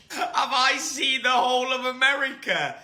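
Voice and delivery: mock question. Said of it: "repeats question then answers".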